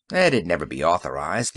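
low, bitter and flat-voiced; understated bitterness. A narrator's reading. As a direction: spit it out low and sour. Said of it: "he said bitterly".

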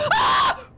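sudden high piercing shriek; startled scream. Real, not acted.

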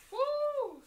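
a high, loud squeal of delight; excited woo. Real, not acted. Vlogger cheering as she pulls out the perfume, high excited woo. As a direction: let out a burst of joy at the reveal. Unveiling the perfume in her haul.

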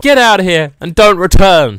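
loud, high dismissal; mock angry shout. Shopkeeper banishing a customer he doesn't need, mock angry shout. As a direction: shout them out the door.

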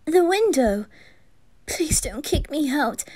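high, flustered apology; worked-up, high-pitched begging; anxious pleading. Acted. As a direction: plead nervously for forgiveness. Pleading not to be thrown out.

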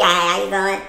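mock indifferent mumbled hello; barely bothered greeting. Wife mimics husband who barely speaks, half-hearted. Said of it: "you barely speak".

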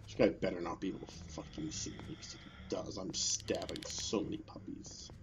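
hushed whisper while hiding. Real, not acted. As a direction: whisper so the enemy won't hear.

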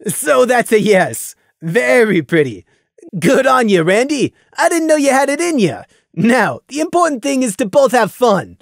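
hearty teasing buddy; teasing friend. Acted. Fiera jovial.

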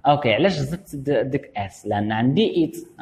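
ordinary talk

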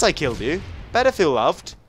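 usual speaking voice

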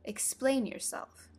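firm, deliberate order; stern. A narrator's reading. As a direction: demand an answer severely. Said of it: "said the caterpillar sternly".

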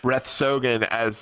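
ordinary delivery

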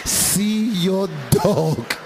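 lightly voiced calm clerk, slow; a hint of an unbothered clerk. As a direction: ask it slow and patient. Clerk calmly asks.

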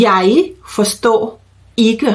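slow model phrase with a pause after each word. Shown as a demonstration. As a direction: say each word separately for learners. (พูดช้าๆ ทีละคำ)

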